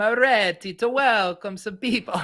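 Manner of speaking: put-on a quick stab at a broad Italian accent. Host opening a mock Italian family welcome, in a hammed-up Italian accent. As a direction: tries a Mario-style Italian voice to open the segment. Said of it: "that was full Mar-, you can't go full Mario".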